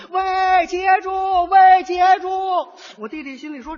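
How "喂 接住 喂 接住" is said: high, loud yell that builds; shouting after him. Retold or imitated. Girl yells at the fleeing rider. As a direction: holler at the rider to stop. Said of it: "那姑娘冲他直喊".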